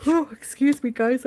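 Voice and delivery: talking through a yawn; mid-yawn, stretched and breathy. Real, not acted. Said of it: "I'm yawning for a second".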